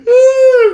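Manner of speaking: high, loud wail after laughing; helpless laughing wail. Real, not acted. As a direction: let out a helpless high cry of hilarity.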